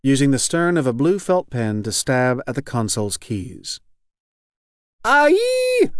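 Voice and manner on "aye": piercing, very high attacking yell; high battle cry. A narrator's reading. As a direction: cry out loud and high as you rush in. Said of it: "cries the man".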